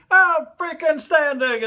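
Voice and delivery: high, slow, loud bark; screaming. Retold or imitated. Drill instructor approves recruit deadpan, high slow bark. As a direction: drag it out at full volume. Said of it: "instructor screamed".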